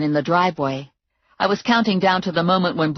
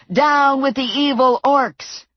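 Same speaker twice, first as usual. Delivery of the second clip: higher, slow, loud charge; rally the troops; battle cry; 8 semitones higher, 3 dB louder, slower than usual. A narrator's reading. Hobbits charging the house, yelling a battle cry. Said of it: "they were yelling".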